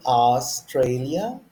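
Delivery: Australia stretched out, rising; slow model pronunciation. Shown as a demonstration. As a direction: sound out Australia for learners to repeat. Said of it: "Can you say it?".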